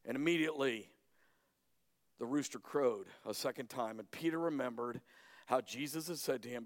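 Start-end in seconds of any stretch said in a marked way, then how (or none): none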